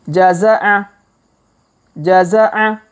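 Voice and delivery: jaza'a said quickly in one go. Shown as a demonstration. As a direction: read jaza'a briskly and smoothly. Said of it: "Kalau dipercepat".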